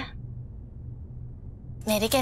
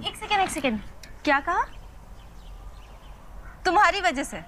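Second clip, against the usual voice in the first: startled questioning; 6 semitones higher, 4 dB quieter, faster than usual. Acted. Startled by the friend's slip.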